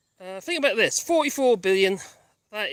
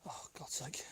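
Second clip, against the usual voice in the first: muttering; 6 semitones lower, 18 dB quieter than usual. Real, not acted. Exasperated aside under breath.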